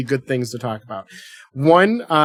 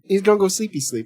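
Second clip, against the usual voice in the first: put-on teasing baby talk; 5 semitones higher than usual.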